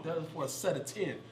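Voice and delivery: ordinary delivery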